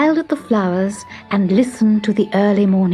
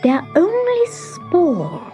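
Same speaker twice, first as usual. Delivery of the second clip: to herself; 8 semitones higher, slower than usual. A narrator's reading. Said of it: "she told herself".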